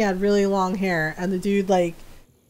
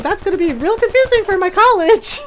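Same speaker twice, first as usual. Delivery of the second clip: high, louder mock-concerned sarcasm; sarcastic retort; 9 semitones higher, 5 dB louder than usual. Shy answering a caller who doubts she's adult, high sarcastic retort. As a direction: imitate a sassy, high-pitched comeback.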